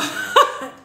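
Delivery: breathy high burst of laughter. Real, not acted. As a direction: let a high laugh escape. Laughing at viewer's joke.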